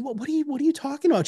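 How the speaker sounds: quick, high-pitched mock bewilderment; baffled reply. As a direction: stammer it fast, as if caught off guard. Retold baffled reply to boss.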